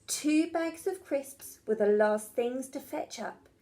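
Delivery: usual speaking voice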